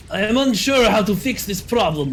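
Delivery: light mock Russian accent. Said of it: "I do not know why I'm switching to Russian accent".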